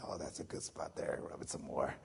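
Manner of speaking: low, hushed, lazy purr; a low teasing purr while being bathed; mock sultry tease. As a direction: coo it low to tease the nurse. Teasing nurses during baths.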